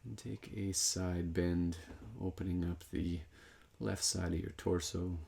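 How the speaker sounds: calm, measured guidance with long pauses; guided meditation. Real, not acted. Meditation teacher guiding breath into the side body, calm guided meditation. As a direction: lead them unhurriedly through each movement, leaving room to breathe.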